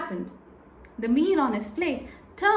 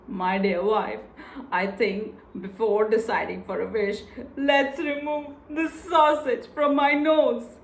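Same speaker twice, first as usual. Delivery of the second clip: weary woodcutter; 2 semitones lower than usual. Acted. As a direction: gently ask her to deal with the nose first. Woodcutter pleads, sausage on nose.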